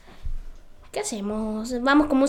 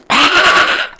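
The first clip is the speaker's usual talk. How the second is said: breathy laugh; 6 dB louder than usual. Real, not acted. Laughs at own joke.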